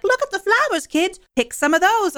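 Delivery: put-on high, singsong call to children; field trip leader. Field trip leader pointing kids to the flowers, high-pitched. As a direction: pipe up brightly as if herding kids. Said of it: "a field trip rolls by, and she's like".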